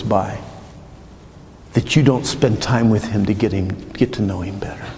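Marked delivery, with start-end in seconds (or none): 3.9-4.9 soft whispered close. Acted. Urging the congregation toward God, whispered. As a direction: whisper the end of the thought. Sermon point softened.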